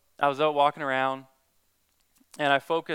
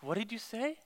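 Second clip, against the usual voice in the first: higher, hushed, urgent question; struck teen asking; 6 semitones higher, 10 dB quieter than usual. Retold or imitated. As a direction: lean in, needing to hear it again. Ringleader asks, struck.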